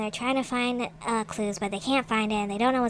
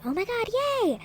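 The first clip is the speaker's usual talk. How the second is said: excited squeal; 11 semitones higher than usual. Real, not acted. Vlogger thrilled the chest holds a music box, high squeal. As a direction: voice leaps high with delight.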